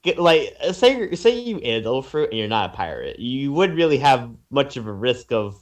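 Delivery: ordinary talk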